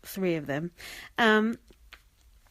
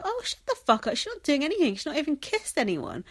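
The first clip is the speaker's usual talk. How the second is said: much higher, fed-up snap; exasperated retort; 7 semitones higher than usual. Retold or imitated. Telling Colleen to shut up, exasperated. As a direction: shoot it back, fed up.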